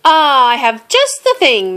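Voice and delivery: high, loud, bright-idea announcement; eager announcement. A narrator's reading. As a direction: announce it with a flourish. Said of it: "Mouse announced".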